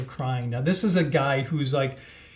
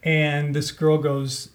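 ordinary talk

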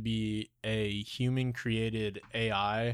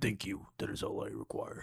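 much lower, whispered robotic alien; 3 semitones lower, 8 dB quieter than usual. Retold or imitated. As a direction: breathe it low and eerie. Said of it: "That voice".

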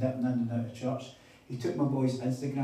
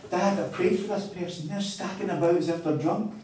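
put-on higher-pitched retelling of an excited boy; lively, raised-pitch quote; excited son, imitated; 6 semitones higher, 4 dB louder than usual. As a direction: imitate a kid eagerly reporting to dad. Acts out son's amazed report.

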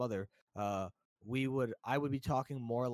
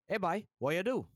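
Guyanese Creole, raised higher and louder; Guyanese accent; 7 semitones higher, 5 dB louder than usual. Shown as a demonstration. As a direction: drop into a casual Guyanese greeting. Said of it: "if I came up to you and was like".

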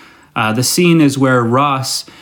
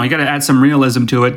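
usual speaking voice

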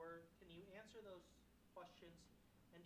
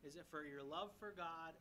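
ordinary talk, nothing marked

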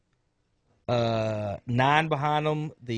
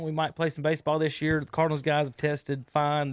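normal talking voice

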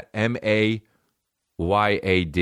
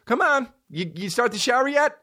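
very high coaxing call, as to a dog; mom's nagging tone. Retold or imitated. Mom trying to get through to him, high coaxing nag. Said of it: "Like talking to a fucking dog".

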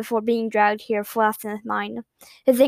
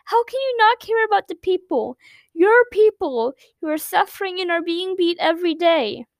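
much higher, pleading through tears; tearful plea; 7 semitones higher than usual. Acted. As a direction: beg him through tears. Girl cries at selfish prince.

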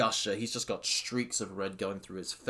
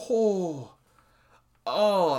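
high, loud wordless awe; awed gasp; 7 semitones higher, 6 dB louder than usual. Real, not acted. Fan recalling brutal kicks, high awed oh. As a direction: let the memory hit you again.

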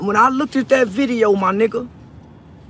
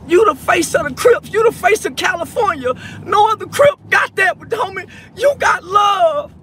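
impassioned shout; 6 semitones higher than usual. Real, not acted. Emotional plea to Crip Mac.

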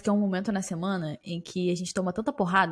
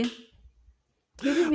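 drawn-out BBB; 6 semitones higher, much slower than usual. Pretend. Co-host introducing the topic, slow drawn-out letters. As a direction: stretch each letter slowly.

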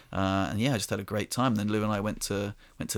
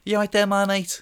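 put-on higher, friendly Bristol-accented greeting; cheerful Bristol local checking on you; 9 semitones higher, 3 dB louder than usual. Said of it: "endearing Bristol accent".

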